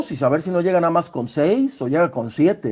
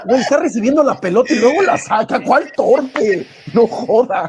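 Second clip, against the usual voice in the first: reclamo agudo con la risa en la voz; defiéndelo incrédulo, agudo y riéndote; protesta entre risas; 6 semitones higher, 3 dB louder than usual. Real, not acted. Protesta riendo por la broma. (protesta indignada entre risas)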